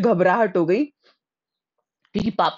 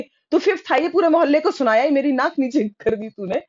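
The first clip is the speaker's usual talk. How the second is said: mock scolding mother; 7 semitones higher than usual.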